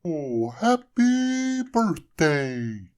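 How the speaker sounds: very slow, high, loud snowman voice; snowman's first words. A narrator's reading. Snowman coming to life, speaking first words. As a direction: stretch it out like waking up. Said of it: "said the snowman".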